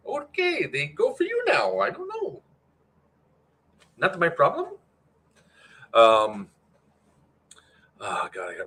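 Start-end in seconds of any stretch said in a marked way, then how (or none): 0.1-4.8 high, put-on Russian accent mocking indifference; bad Russian accent. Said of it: "really gotta work on my, my Russian accent".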